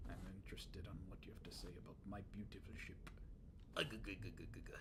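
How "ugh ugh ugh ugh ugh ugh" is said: low, rapid throaty chuckle; put-on sailor's guttural laugh; Popeye chuckle. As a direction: chuckle in quick repeated grunts like a cartoon sailor. Popeye chuckle before his song.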